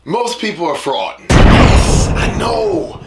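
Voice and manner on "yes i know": loud emphatic yes; shout. Real, not acted. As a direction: shout it in agreement.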